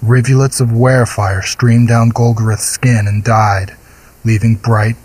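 ordinary delivery, nothing marked

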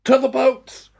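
high, desperate shout. Acted. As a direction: yell over the panic. Crew shouting orders.

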